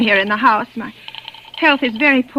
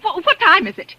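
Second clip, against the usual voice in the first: exasperated; 7 semitones higher than usual. Acted. Frustrated with slow operator.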